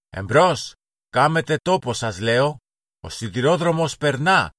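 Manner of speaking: put-on playful shouting boy. A narrator's reading. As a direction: yell to clear the track for your train. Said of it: "ξεφώνιζε ο Μίμης".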